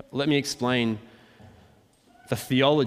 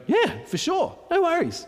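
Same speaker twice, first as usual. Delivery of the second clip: high, cocky mock brag; proud disciples. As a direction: toss it off like boastful disciples. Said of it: "basically go, with all kinds of pride".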